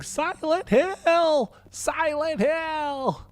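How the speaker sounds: very high, drawn-out mock shriek; hammed-up screaming, each word stretched out high; imitated girl's scream. Player retelling the movie's opening girl to viewers, hammed-up high scream. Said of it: "just writhing and screaming".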